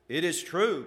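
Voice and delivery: loud, high insistence; countering protest. A narrator's reading. Jealousy countering the scoffing prince, insistent. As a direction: push back emphatically. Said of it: "countered Jealousy".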